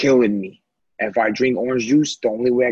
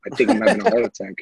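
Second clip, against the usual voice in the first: much higher, fast and laughing; mock panic, laughing; 12 semitones higher, much faster than usual. Teller mocking his panicked hospital plea, much higher and laughing. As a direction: squeal it through laughter.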